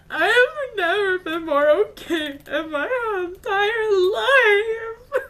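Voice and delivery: high, loud re-enacted sobbing wail; ugly crying. Fan answering if she is okay, re-enacted ugly crying. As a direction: wail it through hammed-up sobs, slow and loud.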